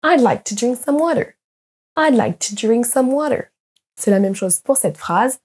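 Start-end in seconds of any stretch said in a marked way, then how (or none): 0.0-3.5 "I'd like to drink some water" with "to" and "some" reduced; weak function words. Shown as a demonstration. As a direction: stress the content words, swallow "to" and "some". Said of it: "vont être à peine prononcés".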